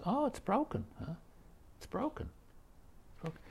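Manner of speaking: high, fading mock musing as if mulling it over; musing, trailing off. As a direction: let it die away to nothing.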